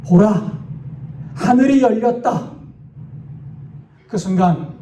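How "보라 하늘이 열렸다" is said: higher-pitched, mildly dramatized cry; calling out. A narrator's reading. Martyr suddenly looks up at the sky, calling out. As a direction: call out in awe, without real volume. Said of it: "갑자기 하늘을 바라보며 외칩니다".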